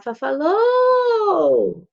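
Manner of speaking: high, loud "Fafalô" with a hugely stretched last vowel; sing-song toddler shout, sliding down at the end; toddler's mispronounced word. Acted. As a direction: imitate a small child calling out in baby talk. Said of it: "ela já não vai falar".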